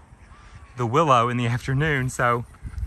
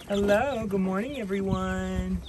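greeting animals; 6 semitones higher than usual. Real, not acted. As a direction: coo a greeting to the hens. Greeting the chickens.

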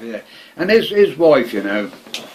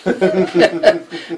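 laugh; 8 semitones higher, 6 dB louder than usual. Real, not acted. Laughing at the surprise.

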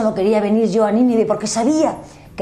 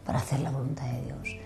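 hushed, unvoiced repeat; whispered echo of "siempre hay una lucha"; 4 semitones lower, 9 dB quieter than usual. Real, not acted. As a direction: whisper the point back. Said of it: "Y en nuestro interior siempre hay una lucha".

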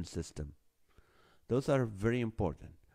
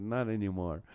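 ordinary talk, nothing marked

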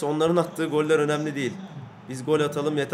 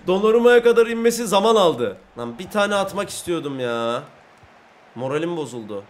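usual speaking voice

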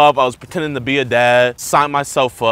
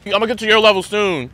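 high, loud holler; calling out; 10 semitones higher, 5 dB louder than usual. Real, not acted. Newbie promising other golfers he'll improve, loud high call. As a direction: shout it across to the other players.

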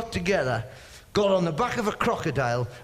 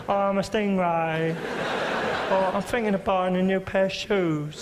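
mild creature voice, slightly raised; placid stingray. Acted. Stingray greeting someone and offering tea, placid creature voice. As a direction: chat amiably as a harmless stingray. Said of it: "That's how placid they are".

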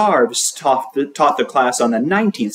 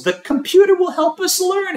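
high teacher voice. A narrator's reading.

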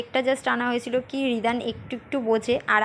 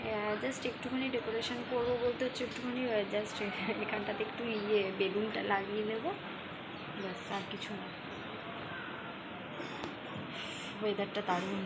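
speaking softly; 13 dB quieter than usual. Real, not acted. Still hushed near sleeping child.

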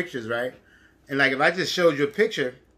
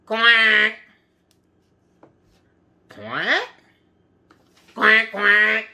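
duck quacking; 6 dB louder, much slower than usual. Pretend. Duckling voiced in story.

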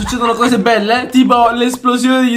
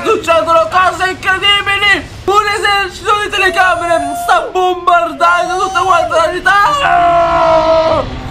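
raised, high-pitched excited shout; excited commentary; 4 semitones higher than usual. Real, not acted. Streamer watching cameras bombard the city, excited shout. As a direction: call out the chaos, drawing out the last words.

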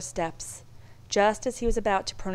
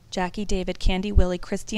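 plain reading voice

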